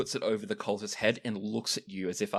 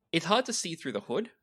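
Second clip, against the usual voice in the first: ordinary delivery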